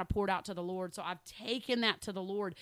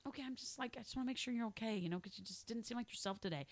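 soft, hushed check-in with a friend; gentle concern; 8 dB quieter, faster than usual. Retold or imitated. Soft and caring, asking a friend if they're okay.

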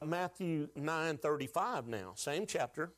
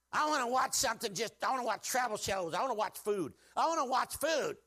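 higher, sing-song whining for treats; a craving child, pleading; whiny flesh voice; 6 semitones higher than usual. Retold or imitated. Pastor voicing his flesh begging for food shows, whiny higher voice. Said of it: "my old flesh goes".

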